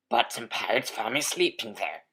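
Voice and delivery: ordinary delivery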